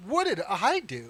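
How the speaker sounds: high, drawn-out mock innocence; bewildered protest. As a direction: plead innocence in disbelief.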